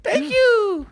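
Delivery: tiny squeaky high voice; cute squeaky voice. Pretend. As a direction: squeak it out like a cute little creature. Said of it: "They have really cute voice".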